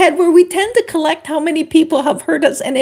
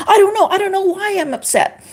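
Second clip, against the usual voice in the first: flustered protest; 6 semitones higher than usual. Retold or imitated. Acted reply to pushy friend.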